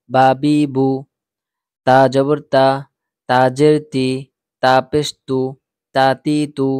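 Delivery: loud, clipped drill chant, syllable by syllable; loud letter drill. Shown as a demonstration. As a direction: call out each syllable loudly for the class to repeat. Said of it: "আমি যখন বলবো তখন আমার সাথে সাথেই উচ্চারণ করবেন". (জোরে জোরে অক্ষর উচ্চারণের অনুশীলন)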